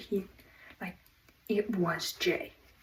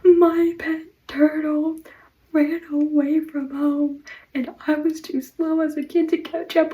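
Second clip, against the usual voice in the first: Jay's sad tale; 7 semitones higher, 8 dB louder than usual. Retold or imitated. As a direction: ham up a whiny childhood sob story. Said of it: "And he's like".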